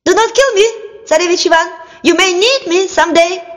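high, squeaky pleading voice; a frightened little rabbit begging. Acted. Rabbit begs Tsarevich Ivan to spare it, high squeak. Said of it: "the rabbit said in a human voice".

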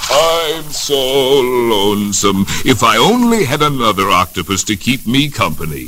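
slow, drawn-out lonesome octopus song-voice; a sad octopus moaning slowly to himself; lonely octopus. Acted. Octopus sings his lonely song.

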